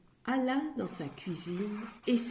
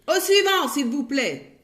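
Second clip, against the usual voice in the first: higher, loud dismissal; shouted next; 6 semitones higher, 8 dB louder than usual. Acted. Grocer shouting for the next customer. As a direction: call the next one loudly.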